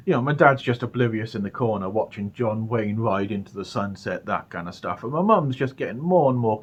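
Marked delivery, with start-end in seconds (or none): none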